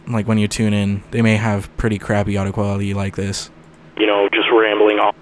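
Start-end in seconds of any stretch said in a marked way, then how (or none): 4.0-5.1 thin, crunchy phone line sound; like a cheap AM radio; telephone voice. Shown as a demonstration. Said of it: "more of a telephone effect".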